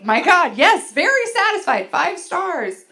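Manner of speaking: high, loud sarcastic praise; exasperated yelling. Retold or imitated. Wife placating sleep-talking husband's survey, exasperated shout. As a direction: shout the rating to shut him up.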